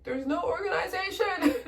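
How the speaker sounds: high exasperated protest with a laugh. Retold or imitated. Telling the pilot there's no organization, exasperated. Exasperated retort in retold phone call.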